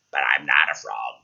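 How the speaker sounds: deep-voiced pleading frog; talking frog. Frog answers princess.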